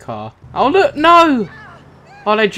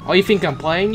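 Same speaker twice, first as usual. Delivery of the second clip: ordinary talk, nothing marked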